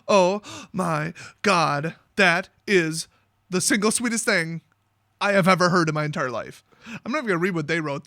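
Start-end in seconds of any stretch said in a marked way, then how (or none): 0.1-6.6 mock gushing. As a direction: drag out each word as if deeply moved. Mock gushing over a sweet answer.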